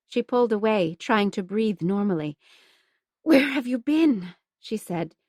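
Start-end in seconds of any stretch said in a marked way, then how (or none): 3.2-4.3 higher, a touch louder, accusing; mild angry outburst at a lost friend; sudden anger. Acted. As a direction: let the hurt flare into anger. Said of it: "surprised by her anger".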